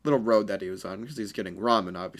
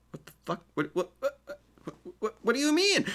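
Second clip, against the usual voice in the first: high, rising confused stammer; shocked girls; 10 semitones higher than usual. Acted. Girls staring at Naruto's back, high shocked stammer.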